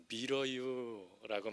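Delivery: Chungcheong dialect, drawn out very slowly; slow Chungcheong drawl. Retold or imitated. Pastor reading the sauna sign, slow Chungcheong dialect. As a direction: stretch it like a slow Chungcheong speaker. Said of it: "이렇게 적혀 있었답니다".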